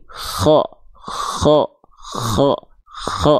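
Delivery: "kho" with a smooth airy hiss on the kh, no rattle; kh without snore. Shown as a demonstration. Teacher showing the alternative school, breathy kh without rattle. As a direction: let plain breath flow through the kh of "kho". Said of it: "nggak ada karakter dengkurannya seperti ini".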